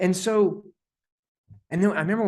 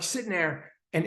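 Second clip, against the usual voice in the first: normal talking voice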